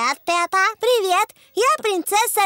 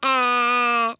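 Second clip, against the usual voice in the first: saying ahh; 7 semitones lower than usual. Pretend. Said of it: "Скажите".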